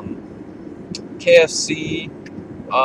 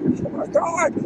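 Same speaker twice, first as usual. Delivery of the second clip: relieved; 12 semitones higher, 8 dB louder, slower than usual. Retold or imitated. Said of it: "I was like".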